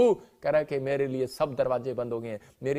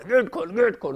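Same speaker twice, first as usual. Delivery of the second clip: retold angry demand; 5 semitones higher, 3 dB louder than usual. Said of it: "हमारे निकलता है".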